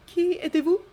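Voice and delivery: high, loud wary French voice; wary French director. Acted. Cornered director faces Jake, high wary French. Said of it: "His voice is wary".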